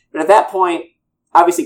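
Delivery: usual speaking voice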